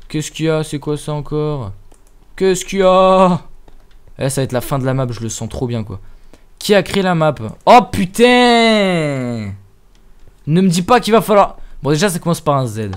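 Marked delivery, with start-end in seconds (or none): 2.4-3.4 drawn-out goofy question; mock voice. Repeats question in odd voice. 7.6-9.5 long drawn-out exclamation of dismay; slow exasperated cry; dismayed groan. Real, not acted. Dismay at creator name.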